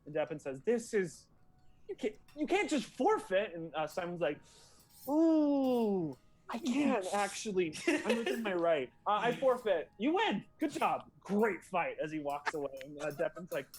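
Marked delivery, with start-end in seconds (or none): 0.7-3.5 high, loud, indignant fighter; outraged protest. Acted. 5.1-12.0 raised, loud, mock-cheery concession; breezy quitter. GM voices breezy forfeiting fighter.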